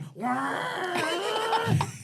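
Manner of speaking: loud, high revving noise; imitated engine roar. Teen flooring it on the hill, imitated engine roar. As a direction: rev hard with the voice.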